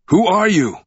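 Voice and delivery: high, loud frantic cry; frantic shout. A narrator's reading. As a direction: shout it across the river. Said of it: "Frantic, he shouted back".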